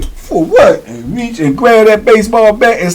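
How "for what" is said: high-pitched, wary retold challenge; mock challenge. Softball player to approaching man, high challenging retort. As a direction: throw it back as a challenge.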